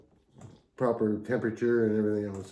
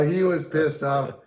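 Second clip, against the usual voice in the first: usual speaking voice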